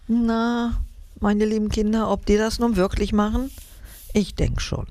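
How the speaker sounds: put-on tuck the children in with your voice; bedtime sign-off. Narrator addresses children goodnight. (sanfte Gute-Nacht-Verabschiedung an die Kinder)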